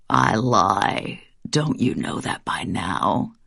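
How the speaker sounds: deep, slow monstrous taunt; slow deep demon gloating; very low demon voice. Acted. Demon reveals himself.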